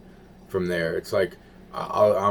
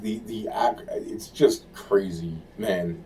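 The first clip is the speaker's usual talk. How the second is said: ordinary delivery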